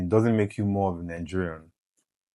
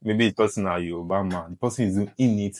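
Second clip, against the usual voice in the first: ordinary delivery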